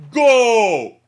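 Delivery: very high, very loud shriek; tantrum scream. A narrator's reading. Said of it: "screams, "Go," like a child throwing a temper tantrum".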